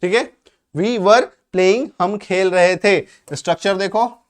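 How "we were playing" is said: very slow, clear teacher model; slow model sentence. Shown as a demonstration. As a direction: spell it out word by word for learners. Teacher modelling example slowly.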